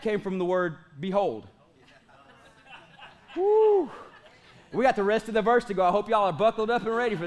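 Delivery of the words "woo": high "woo" whoop; exultant whoop. Real, not acted. Preacher to congregation after a fervent passage, whooping. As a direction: let out a single high whoop between points.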